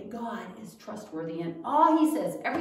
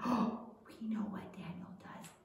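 breathless conspiratorial whisper after a gasp; scheming whisper; 2 semitones lower, 12 dB quieter than usual. Acted. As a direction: whisper it like plotting a secret. Schemers plotting against Daniel.

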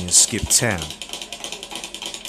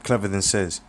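ordinary delivery, nothing marked

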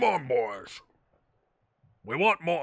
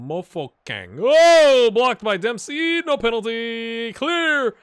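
ordinary talk

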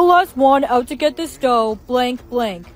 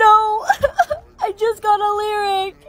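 high, whimpering cry; mock-sobbing dread; tearful panic; 7 semitones higher than usual. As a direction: whine it tearfully, much higher. Dreads being given a lyric.

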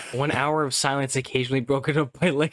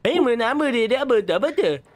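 high excited insistence; eager and keyed up; 10 semitones higher than usual. Real, not acted. Excited reply during game.

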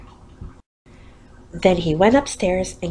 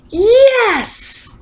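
put-on high, loud cheer of kids; excited yell; 14 semitones higher, 6 dB louder than usual. A narrator's reading. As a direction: whoop it like a happy class. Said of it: "All the kids yelled".

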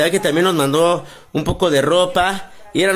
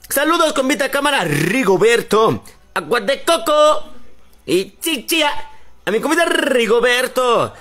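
playful high call; 6 semitones higher than usual. Pretend. Playful high calling out names.

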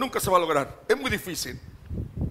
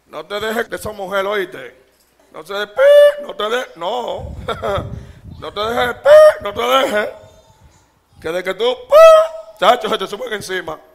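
squeaky laughing mock warning; 9 dB louder than usual.

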